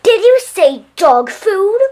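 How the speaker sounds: high, loud and slow outrage; shocked manager screeching the question; outraged shriek. A narrator's reading. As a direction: drag out the disbelief at full volume.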